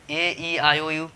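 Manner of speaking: vowel letters said slowly and apart; teacher reciting vowels slowly; slow spelling. Shown as a demonstration. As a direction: list the five vowels slowly for students. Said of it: "से हम बोलते हैं कि जहाँ भी आए पांचों vowel".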